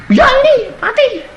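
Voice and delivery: high, loud despair; mother's loud cry. Retold or imitated. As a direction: cry it at him. Mother answering the preacher. (ibu berteriak tinggi dan keras)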